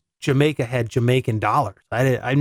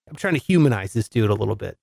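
ordinary delivery

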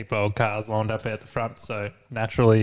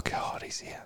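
hushed panicked whisper; scared whisper at the gate; 14 dB quieter, slower than usual. Retold or imitated. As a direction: whisper it so he can't hear. Hiding from the visitor.

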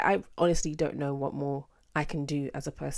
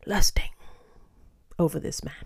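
whisper. Real, not acted. Whispered confession.

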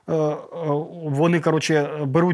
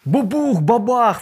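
higher, boomy bubukh, babakh; blast noises; 7 semitones higher, 4 dB louder, slower than usual. Retold or imitated. Shells keep landing, he mimics the booms. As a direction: boom out the blasts.

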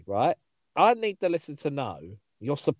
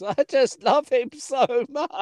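hammed-up high, slow wail; mock crying; 11 semitones higher, slower than usual. Girl crying over her ex, mock wailing. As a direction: imitate a girl breaking down in tears. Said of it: "just starts crying".

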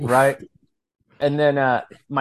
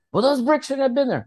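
put-on higher, bratty kid voice protesting the bricks; whiny daughter; 11 semitones higher than usual. Father quoting his daughter blaming the bricks, whiny higher voice. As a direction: play the pouting daughter, pitched up.

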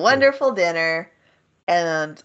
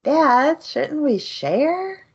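high, cute little kid voice, imitated; baby orc; 6 semitones higher, much slower than usual. Retelling the baby orc asking Dad to share, childlike. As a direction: plead sweetly as a small orc, drawing out the last words. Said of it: "And then this baby orc".

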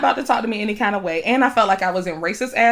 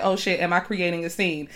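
quiet inner thought; 3 semitones lower, 7 dB quieter than usual. Retold or imitated. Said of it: "I just was like".

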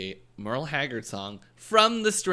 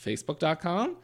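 ad read; 4 semitones higher, 9 dB louder than usual. Acted. Reads podcast plugs.

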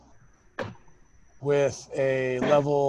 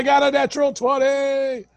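excited; 10 semitones higher, 3 dB louder than usual. Real, not acted. Rolls a crit excitedly.

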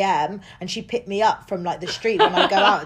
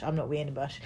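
softer, lower repeat; quieter; 6 semitones lower, 10 dB quieter, much faster than usual. Retold or imitated. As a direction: drop it to a mutter. Repeated refusal.

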